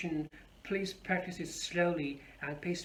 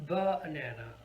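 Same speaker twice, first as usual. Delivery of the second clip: "banana" slowly as BA-na-na; stress on first syllable; 3 semitones lower than usual. Shown as a demonstration. Teacher asks which stress is correct, first stressed. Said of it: "where are we going to put the stress".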